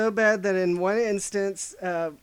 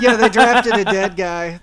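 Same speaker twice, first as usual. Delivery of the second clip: laughing; 9 dB louder than usual. Real, not acted. Laughing while telling it.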